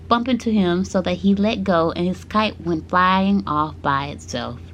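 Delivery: ordinary delivery, nothing marked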